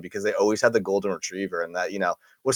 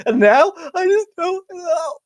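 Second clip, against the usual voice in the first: mock crying; 4 dB louder, slower than usual. Mock hurt after teasing.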